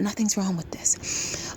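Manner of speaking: hushed emphatic whisper. Shown as a demonstration. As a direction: whisper it slowly to drive the point home. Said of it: "Let me emphasize that".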